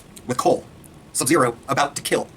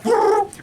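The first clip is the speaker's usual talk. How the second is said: high burst of laughter; 4 dB louder than usual. Real, not acted. Laughing at own impression.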